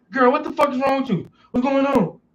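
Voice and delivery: quick worried questions on the phone; alarmed. Retold or imitated. Answering sister on phone.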